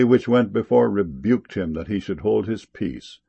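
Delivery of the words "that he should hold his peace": usual speaking voice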